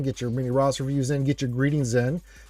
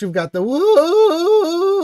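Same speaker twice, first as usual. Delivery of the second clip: a long, drawn-out, howling "Wolf", much higher and louder; howled name; 10 dB louder, much slower than usual. Pretend. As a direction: stretch "Wolf" into a wolf howl. Announcing viewer Wolf Man.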